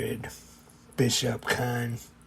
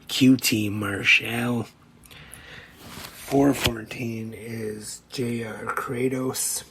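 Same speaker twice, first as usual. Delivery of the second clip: usual speaking voice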